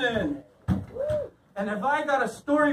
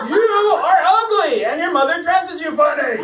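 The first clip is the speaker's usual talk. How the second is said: higher, louder, pushing the insult harder; louder taunt; 7 semitones higher, 4 dB louder than usual. Retold or imitated. To Derek after the beer, louder taunt. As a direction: push the same insult harder this time. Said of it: "I was like".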